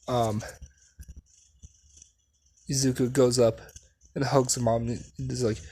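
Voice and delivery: ordinary talk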